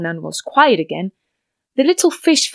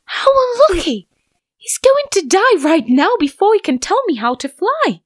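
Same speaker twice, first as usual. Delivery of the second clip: high, worried little fish voice; a tiny fish fretting aloud; dismayed little fish; 10 semitones higher than usual. A narrator's reading. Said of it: "the little fish thought".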